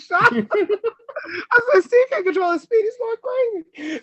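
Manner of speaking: high tearful laughing speech; laughing hard. Real, not acted. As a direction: wheeze it out while laughing. Laughing at Steve's speed.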